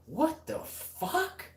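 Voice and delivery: high, drawn-out disbelief; slow, high-pitched "are you kidding me" reaction; incredulous comeback. Retold or imitated. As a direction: retell it stunned, stretching each word. Retelling his stunned comeback to threat.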